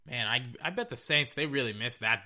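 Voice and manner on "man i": normal talking voice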